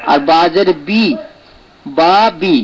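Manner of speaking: বি with a long, pure i; correct vowel sound. Shown as a demonstration. Teacher modelling what it should be, maruf vowel. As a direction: draw out বি as a clean ee. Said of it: "এটা যেন হয়".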